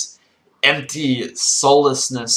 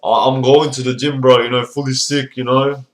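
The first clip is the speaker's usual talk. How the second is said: lightly voiced bragging gym bro; mock a protein-chugging lad; meathead gym bro; 3 dB louder than usual.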